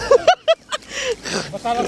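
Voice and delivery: high, giddy laugh; excited laughter. Real, not acted. Fishermen amazed at the catch, high laughing. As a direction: laugh hard in a high pitch.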